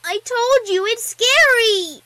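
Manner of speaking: put-on high, loud child protest, stretched out; squeaky little-girl insistence; defensive little filly. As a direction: whine loudly like a scared small kid. Filly defends her fear.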